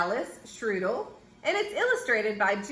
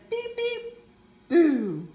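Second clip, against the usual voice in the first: high beeps dropping to a punchy "boo"; truck horn, then boo; 4 semitones higher, slower than usual. A narrator's reading. Little Blue Truck unmasked as the ghost, beeps and boo. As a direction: toot high, then spring a playful boo. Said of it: "Little Blue Truck says".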